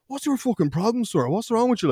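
high-pitched, sing-song protest; mock indignant. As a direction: act affronted at being woken. Teacher confronted by boys faking sleep.

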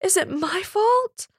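high, slow tearful plea; crying. A narrator's reading. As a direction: ask it through tears. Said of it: "she cried".

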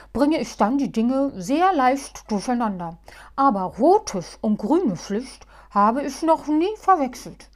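loud, firm lecturing voice; stern. Acted. Muff sternly rebuking panicky Halbschuh. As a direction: put him firmly in his place. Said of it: "Muff aber sagte streng".

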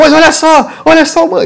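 high, excited TV race commentator; Galvão Bueno. Retold or imitated. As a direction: shout it up high like a thrilled broadcaster. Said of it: "Galvão falou".

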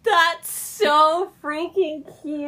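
high, loud and slow, squealing over something adorable; drawn-out squeal of delight; gushing. Real, not acted. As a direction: stretch it out like you can't handle the cuteness. Gushing over a cute comic scene.

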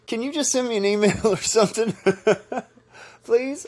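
high, breaking into laughter; laughing plea. Real, not acted. Host begs Emily for an email, laughing plea. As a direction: beg through helpless giggles.

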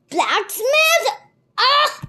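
loud, high exclaimed question; startled outburst. A narrator's reading. Captor reacting to the boy's relative, startled loud outburst. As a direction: seize on the word loudly. Captor alarmed at word blacksmith.

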